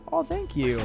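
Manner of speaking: high coy voice. Pretend. Coy reply to compliment.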